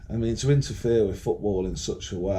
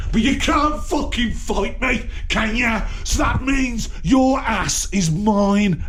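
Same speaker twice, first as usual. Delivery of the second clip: high, loud angry shout; real shouted taunt, pitched right up; angry challenge; 11 semitones higher, 4 dB louder than usual. Real, not acted. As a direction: shout it as a furious threat. Challenging absent king to a fight.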